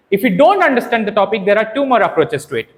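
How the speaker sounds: usual speaking voice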